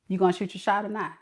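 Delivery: low, quick, soft aside; muttered impatience. Acted. Woman waiting on a man to flirt, muttered impatience. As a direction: mutter it under your breath, fast.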